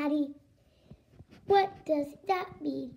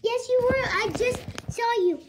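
insistent; 5 semitones higher, 5 dB louder than usual. Pretend. Girl insists to mom.

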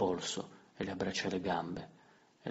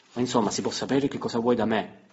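sharp, louder and higher; exasperated demand; 4 semitones higher, 8 dB louder than usual. Acted. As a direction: push back at him, annoyed. Emilia spazientita affronta il narratore. (domanda esasperata, più forte)

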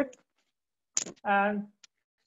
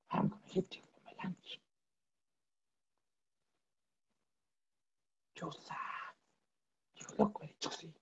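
much softer, whispered tiger voice; a tiger hissing at his juicy lunch; 2 semitones lower, 12 dB quieter than usual. Acted. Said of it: "This is a tiger".

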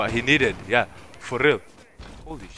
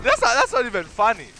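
indignant complaint; 12 semitones higher, 7 dB louder than usual. Real, not acted. Outraged at lobby.

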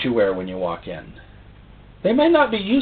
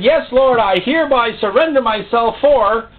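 fervent yes; 9 semitones higher, 4 dB louder than usual. Retold or imitated. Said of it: "And the day you said".

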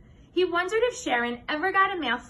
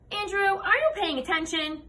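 higher, lightly voiced teacher checking on a pupil; teacher calling out; 7 semitones higher than usual. A narrator's reading. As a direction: call a pupil to attention, pitched up.

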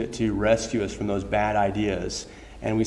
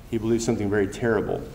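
normal talking voice

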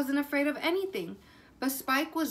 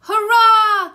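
much higher and louder, a big happy cheer; 9 semitones higher, 17 dB louder than usual. Acted. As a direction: throw your arms up and cheer it. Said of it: "cheered Plank".

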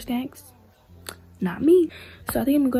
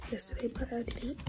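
whispering; 10 dB quieter than usual. Real, not acted. Whispers on while getting ready.

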